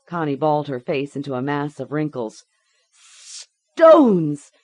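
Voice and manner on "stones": very loud, high scream. A narrator's reading. Girl screams the lie under the shock, full scream. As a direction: scream the word out in pain. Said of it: "she screamed".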